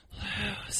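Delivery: high, very soft airy whoosh of a word; voice of the wind. Retold or imitated. The wind mocking Jerry as it passes, soft and airy. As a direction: let the word blow past like wind. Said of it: "this wind goes".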